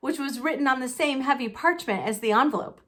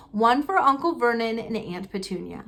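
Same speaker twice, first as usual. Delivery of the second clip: plain reading voice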